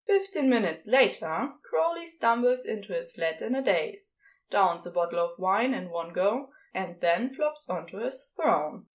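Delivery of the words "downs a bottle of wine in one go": ordinary delivery, nothing marked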